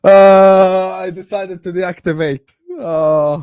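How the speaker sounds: loud, high, hammed-up wail; drawn-out mock lament with groans either side. Streamer lamenting a lost Scottish girl, mock wailing.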